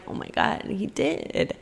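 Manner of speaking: breathless whisper of realization; awed whisper. Retold or imitated. She realizes God gave her a blessing, whispered. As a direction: whisper it as it dawns on you.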